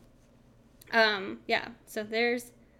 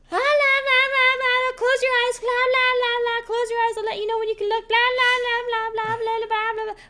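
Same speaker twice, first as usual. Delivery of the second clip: loud, high mock babble; babbling over it; 14 semitones higher, 9 dB louder than usual. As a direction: drown out the sound like a nagging mom. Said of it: "She would, like, babble over it".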